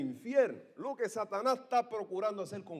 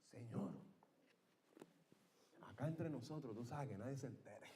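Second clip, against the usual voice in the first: hushed, softer and lower confidential plea; secretive aside; 6 semitones lower, 14 dB quieter than usual. Acted. Ashamed disciples privately asking Jesus, hushed. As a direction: keep it just between us.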